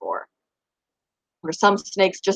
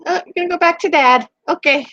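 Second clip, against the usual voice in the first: pet talk; 7 semitones higher, faster than usual. Real, not acted. Telling the rat she's going back to Dad, high pet talk. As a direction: chirp it to the rat.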